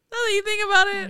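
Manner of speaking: suddenly high and loud, mock outburst; hammed-up high-pitched self-quote; acting out own reply. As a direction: jump up high, playing it up. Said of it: "And I go".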